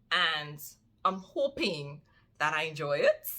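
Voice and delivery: ordinary talk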